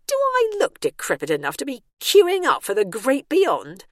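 high, loud indignant question. A narrator's reading. Bernice complains to herself about the old people's home, indignant. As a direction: raise pitch and volume in outrage.

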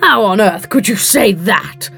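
belligerent, affronted bluster; blustering, combative retort. A narrator's reading. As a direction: challenge her with indignant force. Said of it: "Montmartre said belligerently".

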